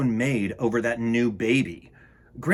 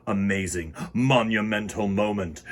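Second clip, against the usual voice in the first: ordinary delivery, nothing marked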